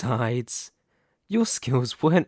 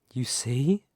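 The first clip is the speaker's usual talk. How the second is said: ordinary talk, nothing marked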